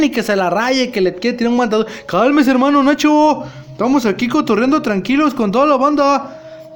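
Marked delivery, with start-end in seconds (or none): 2.1-6.7 calming him down. Acted. Narrator calming an angry Nacho, higher and pleading. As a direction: settle him down, pitched up.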